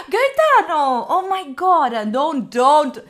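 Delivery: loud, drawn-out exasperated pleading; hammed-up scandalised appeal; mock scolding. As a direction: scold the chat in playful dismay. Mock scolding of a toxic chat.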